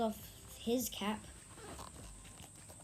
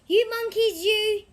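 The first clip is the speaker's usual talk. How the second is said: much higher and louder, scolding; scolding the monkeys; 11 semitones higher, 11 dB louder than usual. Acted. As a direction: wag a finger and scold them hard. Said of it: "he said, shaking a finger at them".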